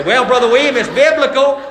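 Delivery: a laughing put-on voice; mock excuse. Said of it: "You say".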